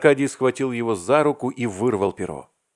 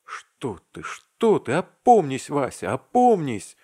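urgent shout; 7 semitones higher, 5 dB louder, slower than usual. A narrator's reading. Arkady urgently rouses friend.

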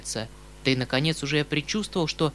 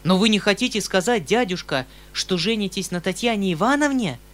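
higher, louder alarmed exclamation; frightened cry; 5 semitones higher, 5 dB louder than usual. A narrator's reading. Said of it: "вскричал я в испуге".

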